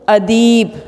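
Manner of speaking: "अदीब" with a long, held ee; long vowel. Shown as a demonstration. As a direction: stretch the second syllable of "अदीब". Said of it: "तो मैं लफ्ज़ बोल रही हूँ". ("अदीब" में ई को लंबा खींचकर)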